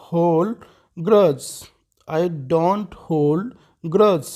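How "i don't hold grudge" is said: slow, word by word for learners; deliberate classroom model reading; slow teaching repeat. Shown as a demonstration. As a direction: spell it out slowly for a student. Teacher repeats sentence slowly.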